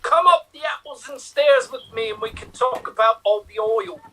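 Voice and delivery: put-on Cockney-style English; English accent. Inviting his partner upstairs to talk oil, mock English accent. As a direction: play up a rhyming-slang English accent. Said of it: "continue this with an English accent".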